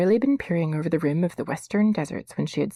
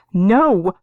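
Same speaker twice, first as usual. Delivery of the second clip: loud frightened cry of no; girl fleeing, crying out no; 6 semitones higher, 9 dB louder than usual. A narrator's reading. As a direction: cry out in fear. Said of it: "cried Ariel".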